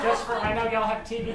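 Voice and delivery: put-on a light Louisiana accent, laughing. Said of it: "this is what the accent sounds like".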